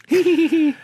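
high burst of giggling; giggle. Acted. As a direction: giggle out loud. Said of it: "I gestured to Christopher and giggled".

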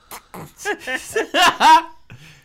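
high loud burst of laughter; loud cackle. Real, not acted. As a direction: crack up loudly.

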